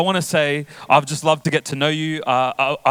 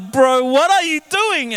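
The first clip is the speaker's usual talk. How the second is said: much higher, slow, incredulous "Bro, what are you doing?"; mock disbelief; 10 semitones higher, slower than usual. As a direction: stretch it out like a baffled dad. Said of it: "And I'm like".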